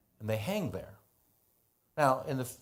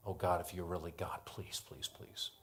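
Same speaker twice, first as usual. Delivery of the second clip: softer and softer, down to an unvoiced whisper; a frightened king begging God under his breath; desperate whispered prayer; 4 semitones lower, 11 dB quieter than usual. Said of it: "You can imagine the king".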